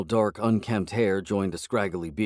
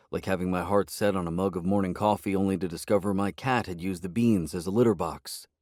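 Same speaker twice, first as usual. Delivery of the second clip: ordinary delivery, nothing marked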